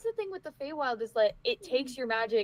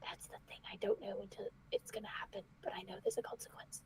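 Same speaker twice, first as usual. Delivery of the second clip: hushed whisper; 6 semitones lower, 15 dB quieter than usual. Acted. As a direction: whisper it so no one hears. Secret whispered in character.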